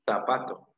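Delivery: "zapato" starting with a th sound; th-like Z opening "zapato"; Castilian Z. Shown as a demonstration. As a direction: say "zapato" as in Spain, with a lisped Z. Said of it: "zapato con Z".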